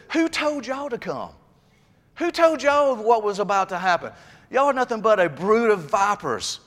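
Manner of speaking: high, forceful prophet's rebuke; John the Baptist rebuking. Retold or imitated. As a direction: hurl the accusation at the Pharisees. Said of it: "he looked at them and said".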